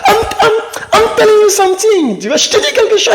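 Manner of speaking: very high laughing insistence; high put-on laughing voice; insisting friend.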